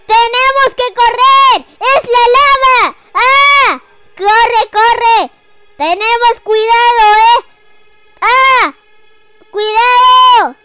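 high loud panicked yells; panicked shouting. Pretend. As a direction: scream to flee the lava. Fleeing lava in game.

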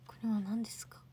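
quietly, almost under your breath; whispered question. Real, not acted. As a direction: whisper it to yourself, unsure. Puzzling over a viewer's picture. (ささやき声で)

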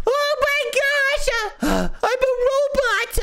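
high, slow amazed cry; excited. Acted. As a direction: gasp with astonished delight. Discovers he is a robot.

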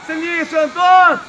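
loud shout of a player's name; calling out. Real, not acted. Shouting to a player during the match, loud call. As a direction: holler to a player across the pitch.

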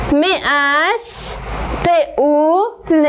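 م ا ش ق او ل, each sound said apart with pauses. Shown as a demonstration. Teacher sounding out مشغول letter by letter, slow. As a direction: spell out the sounds one by one. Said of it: "همراه من تکرار کنین".

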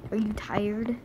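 soft whisper to a sleepy pet. Real, not acted. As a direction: hush it to the yawning dog. Whispering to dog.

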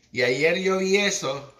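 ordinary delivery

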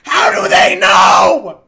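very loud, drawn-out disbelieving shout; exasperated yell. Real, not acted. Gamer after teammates say he sounds like Jinxie, exasperated yell. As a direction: wail it at full volume, slowly.

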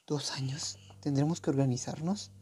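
hushed, breathless whisper. Acted. Character whispering a plan to the group.

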